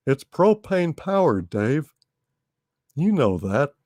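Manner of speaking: lightly voiced Texan salesman; Texan propane salesman Hal.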